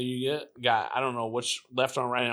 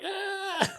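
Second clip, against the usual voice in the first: mock scream. Fugitive caught in the helicopter spotlight, high mock scream. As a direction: imitate a startled yelp way up high.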